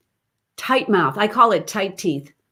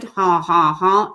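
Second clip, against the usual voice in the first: constricted honks; slower than usual. Shown as a demonstration. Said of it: "feel that this is a little constricted".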